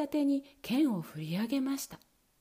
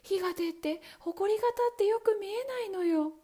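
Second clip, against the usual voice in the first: a lightly higher sister's voice; sister answering; 9 semitones higher than usual. Acted. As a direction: answer from the tower top. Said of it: "アンヌ姉様は答えました".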